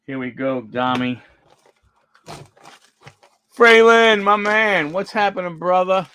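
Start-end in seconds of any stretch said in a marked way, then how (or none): 3.6-6.1 loud, high, pumped-up bro greeting; hyped greeting. Pretend. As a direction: hype up the card like your best buddy. Greeting a card like a friend.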